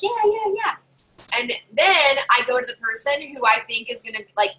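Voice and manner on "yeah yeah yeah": mock group cheer. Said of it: "And then, like".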